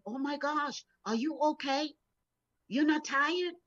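mildly voiced astonished nurse; nurse's surprise. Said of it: "the therapy nurse looked at me".

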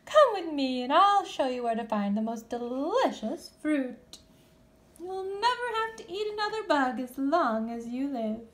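high, loving invitation; tender mother bat. Acted. Mother Bat reassuring Stellaluna, tender. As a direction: coax her kindly.

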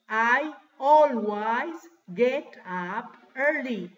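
very slow, word-by-word delivery; slow model sentence. Shown as a demonstration. Teacher translating "siempre me levanto temprano" for class, slow model sentence. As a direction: spell out each word loudly for beginners.

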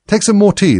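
high, loud, insistent offer; very earnest. A narrator's reading. March Hare offering Alice tea, very earnestly. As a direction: press the offer with great earnestness. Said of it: "very earnestly".

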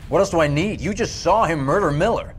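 high-pitched, exasperated challenge; indignant retort. Acted. Sergeant to interrogators who doubt his evidence, indignant. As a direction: throw the question back in disbelief.

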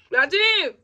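loud, high call across the house; yelling for her father to come try it. Real, not acted. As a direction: shout for dad to come. Calling her father over.

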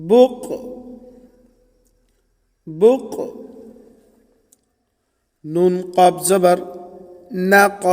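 জোরে, উঁচু গলায় বানান করে পড়ানো; ছাত্রদের সাথে বলাতে স্পষ্ট উচ্চস্বরে পড়ুন; উচ্চস্বরে বানান অনুশীলন. Shown as a demonstration. Teacher drills spelling aloud. (মক্তবের শিক্ষক ছাত্রদের বানান শিখিয়ে উচ্চস্বরে পড়াচ্ছেন)

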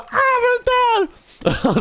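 put-on very high shrieking startled girlfriend, drawn out; imitated scream. Girlfriend watching the game, terrified at the crocodile attack, high shriek. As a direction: shriek like she just saw the crocodile. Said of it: "hon skriker och bara".

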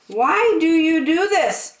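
high, small, hurt voice for the little dog; poor little Trevor. Acted. Trevor pleading with Pig after being blamed, high and hurt. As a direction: squeak it out like a wounded little pup. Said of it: "asked poor little Trevor".

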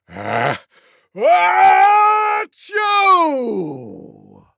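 slow build-up, long loud achoo; huge acted tiger sneeze; loudest pretend sneeze. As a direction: wind up, then explode high and fall away. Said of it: "loudest sneeze you could ever imagine".

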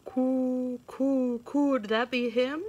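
high, loud cooing dove voice. Acted. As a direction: coo like a mourning dove. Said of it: "asked the mourning dove".

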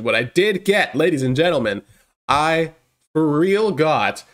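ordinary talk, nothing marked

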